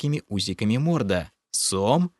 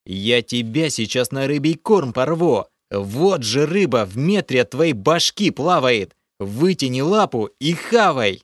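loud, raised furious threats; angry threat; 4 semitones higher, 5 dB louder than usual. A narrator's reading. Hero threatens the water spirit, loud and furious. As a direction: rage at the stubborn creature.

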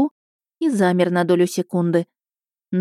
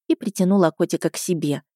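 plain reading voice

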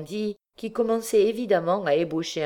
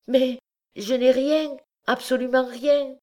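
distressed protest; 10 semitones higher, 4 dB louder than usual. Acted. Wife protesting.

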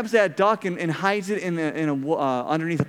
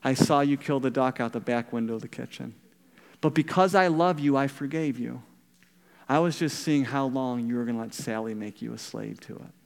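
tender grandmother; 5 semitones lower than usual. Retold or imitated. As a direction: speak gently, full of love. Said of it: "beautiful comment".

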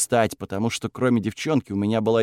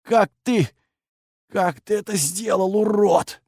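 much higher, shocked thug; stunned thug voice; 9 semitones higher, slower than usual. Acted. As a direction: gasp it out in disbelief. Beaten leader gasps question.

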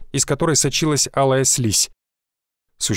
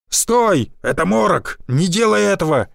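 urgent shout; 4 semitones higher than usual. Acted. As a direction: yell to stop him. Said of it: "выкрикнул Гвоздев". (отчаянный выкрик)

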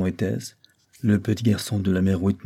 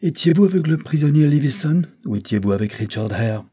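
high, raised severe questioning; ringing severity; 7 semitones higher, 4 dB louder than usual. A narrator's reading. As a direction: press the witness sternly, voice ringing out. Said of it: "retentissante sévérité". (sévérité retentissante, voix haute)

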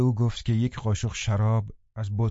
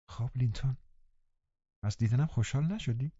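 put-on soft, gentle girl's question; gently; 3 semitones higher, 6 dB quieter than usual. A narrator's reading. Catherine gently asking her sick cousin. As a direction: ask kindly, softly. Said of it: "کاترین آرام گفت".

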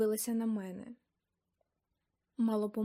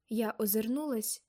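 usual speaking voice

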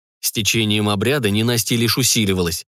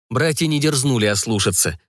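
usual speaking voice

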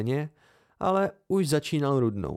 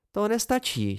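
playful teasing, higher; 8 semitones higher than usual. A narrator's reading. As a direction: tease him lightly with a raised voice. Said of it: "škádlila mě". (škádlivě a výš)

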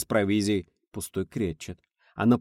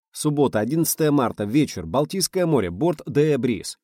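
diary heading; 6 semitones higher, 5 dB louder than usual. A narrator's reading. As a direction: announce the date and place. Diary date heading read. (как заголовок дневника)

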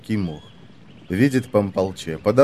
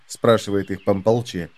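ordinary delivery

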